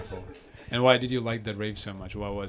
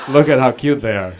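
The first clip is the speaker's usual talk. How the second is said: gushing dad; 11 dB louder than usual. Retold or imitated. Dads voiced gushing over kids.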